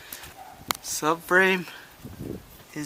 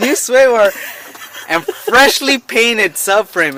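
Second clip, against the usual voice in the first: used car salesman; 6 semitones higher than usual. Acted. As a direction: pitch it like a TV car ad. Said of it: "you sound like a used car salesman".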